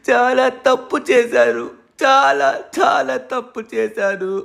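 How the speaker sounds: mock-sob the name Preethi over and over; crying for lost love. Heartbroken man pining for Preethi while strangers watch, hammed-up crying. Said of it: "ఎందుకు ఏడుస్తున్నాడు ఇతను?".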